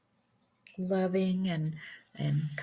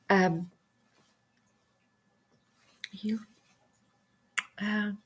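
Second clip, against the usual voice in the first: choked up; 4 semitones higher, slower than usual. Real, not acted. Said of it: "that has me. Sorry, guys".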